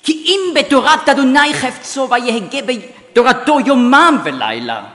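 Hebrew psalm declaimed with strong intonation and accents; emphatic, clearly articulated Hebrew recital; Hebrew recitation. Shown as a demonstration. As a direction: recite in Hebrew, building to fast, loud, high phrases. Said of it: "wenn ich in einer Fremdsprache spreche".

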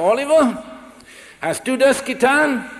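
re-enacted stern questioning; stern father confronting son. Father confronts son in retold scene.

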